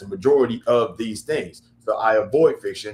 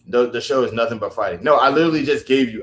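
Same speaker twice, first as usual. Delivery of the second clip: ordinary talk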